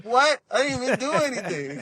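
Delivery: put-on high, whiny protest like a grounded child; indignant kid. Kid protesting to his mom, high whiny disbelief. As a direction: play the wronged little kid who did nothing. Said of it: "I'm like".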